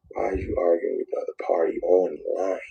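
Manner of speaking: hushed, voiceless self-warning; whisper. Retold or imitated. Man whispering a warning to himself about online fights. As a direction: whisper it to yourself as a rebuke. Coaching himself to stop arguing.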